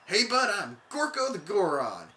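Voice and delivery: laid-back surfer dude, slow; a hint of a chill surfer. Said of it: "a Goron have a surfer voice".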